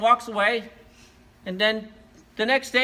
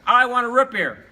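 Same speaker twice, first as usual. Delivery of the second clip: loud, high insistent demand; pounding demand; 6 semitones higher, 8 dB louder than usual. Acted. Ignored dog pounds on the counter, loud demand. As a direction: raise pitch and volume, demand it. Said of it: "he pounded on there".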